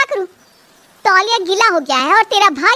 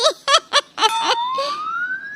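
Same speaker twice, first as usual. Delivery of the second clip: high giggle; 6 dB quieter than usual. Acted. Lily laughs at joke.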